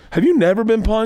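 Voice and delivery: high-pitched disbelief; mock astonishment, rising at the end; incredulous question. Comedian to privileged trash-talkers, acted incredulous question.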